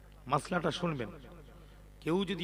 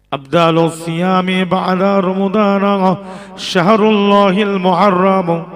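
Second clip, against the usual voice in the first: loud, sing-song sermon chant with drawn-out phrase endings; ওয়াজের সুর; 3 semitones higher, 16 dB louder, slower than usual. Real, not acted. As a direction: chant it out to the whole congregation, louder and stretched. (সুরেলা উচ্চকণ্ঠে ওয়াজ)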